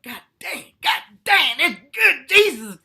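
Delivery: high, loud, hammed-up rapture; mock ecstatic lover. Lover exclaiming over the comic's body, hammed-up ecstasy. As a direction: gush like a partner overwhelmed in bed. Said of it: "they were like".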